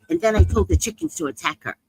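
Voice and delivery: ordinary talk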